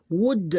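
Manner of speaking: "would" as one loud, high beat; one syllable. Shown as a demonstration. Teacher showing one syllable, loud and separated. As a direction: punch out "would" short with a firm final d. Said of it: "That's one syllable".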